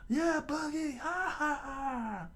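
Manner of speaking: very high squeaky falsetto; squealing minion agreement; sycophantic henchmen. Acted. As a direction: laugh along with the boss in falsetto. Crew answers Buggy's joke.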